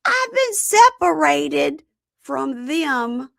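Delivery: high, loud, drawn-out protest; mock protesting woman. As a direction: stretch the words in exaggerated high-pitched indignation. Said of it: "And she goes".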